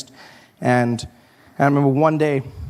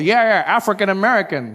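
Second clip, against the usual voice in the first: mock labeling; 6 semitones higher than usual. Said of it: "they call it".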